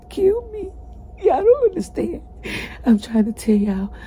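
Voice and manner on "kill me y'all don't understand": real crying, voice jumping higher; tears break through as she pleads. Real, not acted. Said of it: "without getting upset 'cause I can barely breathe".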